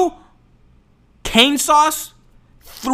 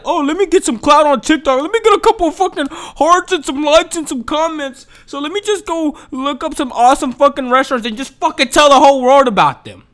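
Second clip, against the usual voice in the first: mocking TikTok clout chaser; 7 semitones higher than usual. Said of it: "so they're like".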